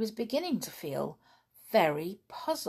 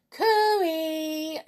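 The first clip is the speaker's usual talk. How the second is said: high, sing-song call; shouting cooey; 11 semitones higher, 7 dB louder than usual. A narrator's reading. The children call cooey to the seeker. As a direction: call out from a hiding place. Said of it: "shouted everyone".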